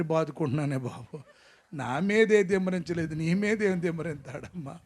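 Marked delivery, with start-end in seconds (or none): none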